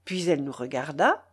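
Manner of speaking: ordinary delivery, nothing marked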